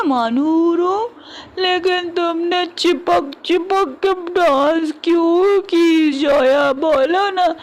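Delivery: put-on high, pouty drunken whining; drunken childish whine. Drunk man whining at wife.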